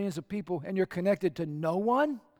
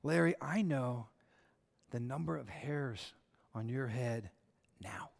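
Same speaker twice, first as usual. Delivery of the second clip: slow, low and much softer; tender, intimate; 6 semitones lower, 6 dB quieter than usual. Acted. Jesus telling Larry he knows every hair, slow and tender. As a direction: lean in close and speak it gently. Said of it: "He even said this".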